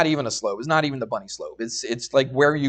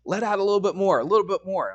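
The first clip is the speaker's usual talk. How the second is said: imitated instructor; 6 semitones higher, faster than usual. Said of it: "and this guy's like".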